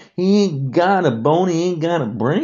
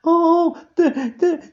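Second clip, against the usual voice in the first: mock whiny crybaby; 14 semitones higher than usual. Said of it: "It's like".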